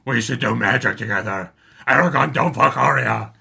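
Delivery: mock dragon voice gargling rocks; Saphira voice. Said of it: "Can you try and recreate it?".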